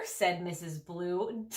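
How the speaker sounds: ordinary delivery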